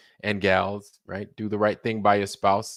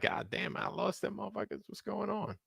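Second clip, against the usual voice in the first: breathy hushed mutter, pitched up; hushed aside; 11 semitones higher, 12 dB quieter than usual. Retold or imitated. Streamer voicing his own worry about losing viewers, hushed and high. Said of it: "I'm like".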